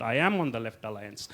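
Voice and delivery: ordinary talk, nothing marked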